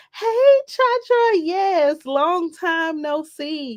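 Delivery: high, slow, singsong hello; excited greeting. Real, not acted. Greeting a returning viewer in chat, high and singsong. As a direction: draw the greeting out, high and happy.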